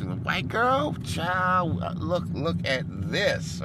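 mocking relatives. Family members gawking at the girl, high mock voice. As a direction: shriek it like nosy family. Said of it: "boy, they just".

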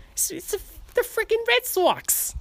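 very high, hammed-up Boston accent. Finding the accent through the team, high Boston voice. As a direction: squeal it like a caricature Boston fan. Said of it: "do a Boston accent".

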